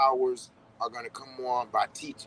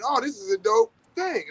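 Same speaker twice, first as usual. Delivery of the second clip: high-pitched mock excitement; naively thrilled; 4 dB louder than usual. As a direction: play someone delighted with a new find. Acting a delighted, unaware driver.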